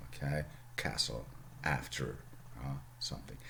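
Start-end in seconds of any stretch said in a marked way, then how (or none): 0.8-2.2 Northern short a. Shown as a demonstration. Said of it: "somebody from Yorkshire ... would speak like that".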